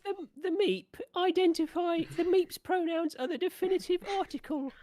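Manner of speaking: high cartoonish piping voice; silly high voice. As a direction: pitch way up like a cartoon alien.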